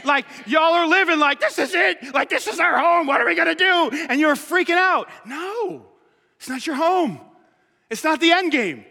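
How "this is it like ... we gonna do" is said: mock panic. Mocking panicked congregation.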